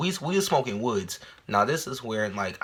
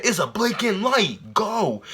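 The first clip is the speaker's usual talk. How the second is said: friend insisting; 9 semitones higher, slower than usual. Retold or imitated.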